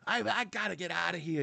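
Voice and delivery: high Boston whine; mock Bostonian desperate to leave; Boston complainer. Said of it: "It's like".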